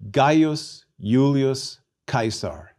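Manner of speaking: Gaius Julius Caesar slowly as GUY-us YOO-lee-us KY-sar; original Latin pronunciation. Shown as a demonstration. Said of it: "out loud in the original Latin".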